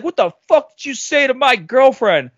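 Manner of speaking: imitating a kid picking a fight; mock confrontation. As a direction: demand an answer like an aggrieved boyfriend. Acted confrontation by angry kid.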